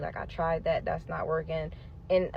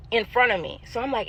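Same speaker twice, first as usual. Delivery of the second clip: usual speaking voice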